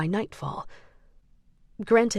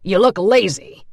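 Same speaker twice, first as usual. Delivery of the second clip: louder, scornful jab; contemptuous; 9 dB louder than usual. A narrator's reading. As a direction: sneer at him. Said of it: "the second man said".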